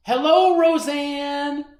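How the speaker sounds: loud, high call across the street; mother calling across the street to a neighbor, loud. Acted. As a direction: hail her from far away.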